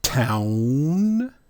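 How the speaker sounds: Town with a long rising "ow"; demonstrated "ow" sound. Shown as a demonstration. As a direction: stretch the vowel of Town upward. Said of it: "I got some words for that too".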